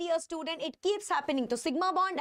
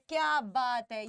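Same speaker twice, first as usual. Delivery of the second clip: ordinary delivery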